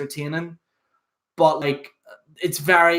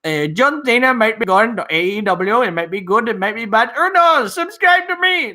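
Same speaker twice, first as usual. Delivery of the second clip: put-on higher and louder Indian accent rising to a squeal; mock Indian accent; 6 semitones higher, 5 dB louder than usual. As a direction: parody an Indian YouTuber's panicked Indian accent. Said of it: "these Indians".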